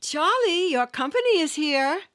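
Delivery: calling out. Acted. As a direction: call him through the door.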